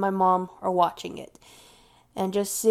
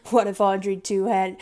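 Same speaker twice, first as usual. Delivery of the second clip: usual speaking voice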